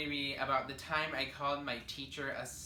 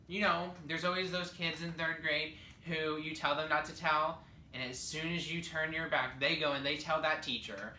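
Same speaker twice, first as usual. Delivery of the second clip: ordinary delivery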